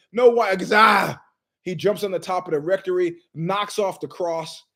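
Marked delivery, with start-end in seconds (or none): 0.1-0.6 mock shout. Monk shouting about wine.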